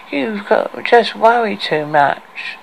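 whining nasal voice. A narrator's reading. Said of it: "in a whining, nasal voice".

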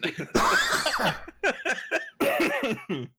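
long falling high giggle; helpless high laugh; high laughing fit. Real, not acted. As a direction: break into giggles. Laughing after quitting.

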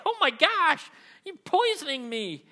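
high, loud, hammed-up outcry; horrified kid. Preacher replays first taste of shrimp as a kid, mock horror. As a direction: wail it high like an overdramatic child. Acting his childhood reaction to shrimp.

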